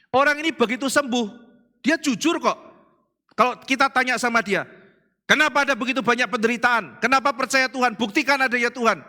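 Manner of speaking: loud, high preaching cry; full-force preaching. Acted. Preacher stirring congregation, loud high preaching. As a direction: preach it hard to the crowd.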